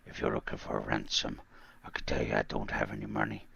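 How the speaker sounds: very low, slow, quiet Irish menace; Irish accent. Acted. Podcaster quoting the Taken phone threat, low Irish accent. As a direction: deliver a hushed, measured threat in an Irish accent. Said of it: "that Irish accent, that one where he says that quote".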